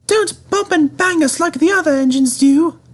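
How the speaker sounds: high, fussy coach voice; coaches. A narrator's reading. Coaches warning Edward not to bump them, high and fussy. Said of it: "said the coaches".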